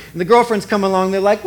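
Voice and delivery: normal talking voice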